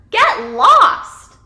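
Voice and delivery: loud, high yell; storyteller's sharp, loud rebuff. A narrator's reading. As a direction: yell it to chase someone off. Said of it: "some rats who yell at her".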